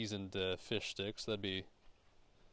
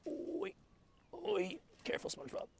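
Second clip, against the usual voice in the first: squeaky mock warning. Jokingly warns SpongeBob.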